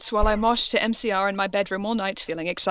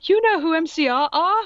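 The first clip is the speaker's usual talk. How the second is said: high, louder gasping question; mock gasp; 8 semitones higher, 4 dB louder than usual. Goth girl amazed at the teacher, mock gasp. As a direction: gasp it in shock. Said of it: "I gasped".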